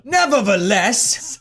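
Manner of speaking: slow, hammed-up British accent; British accent attempt. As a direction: draw it out in mock British. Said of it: "doing this in a British accent".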